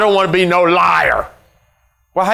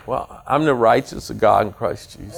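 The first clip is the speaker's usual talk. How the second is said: mumbled reply; 8 semitones lower, 4 dB quieter than usual. Retold or imitated. Congregant's weak flat reply.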